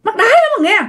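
high-pitched acted exasperation; whine-curse at the thing, pitch shooting up; exasperated cursing. Woman swearing at the lid that keeps dropping, exasperated cursing. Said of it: "bạn nói vậy đó".

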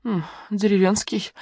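тихо, медленно, недоверчиво; протянуть с сомнением. A narrator's reading. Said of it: "усомнилась Лариса". (недоверчиво протянув)

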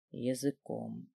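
slow, low, very soft single word; ЯЗЫКОМ drawn out low and hushed; hushed echo. A narrator's reading. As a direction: linger on ЯЗЫКОМ, dropped almost to nothing. Word echoed as his thought lingers.

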